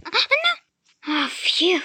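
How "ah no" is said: high, loud alarmed "Ah, no"; high alarmed cry. Real, not acted. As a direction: yelp it out in sudden alarm. Sudden danger in game.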